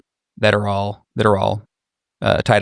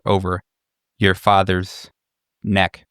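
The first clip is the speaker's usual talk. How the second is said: ordinary talk, nothing marked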